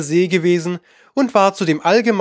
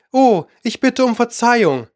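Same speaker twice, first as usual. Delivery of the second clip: higher, mildly alarmed cry; startled apology; 7 semitones higher than usual. A narrator's reading. Alice fears she hurt the poor animal, mildly startled. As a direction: blurt a slightly shocked apology. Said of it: "rief Alice schnell, erschrocken".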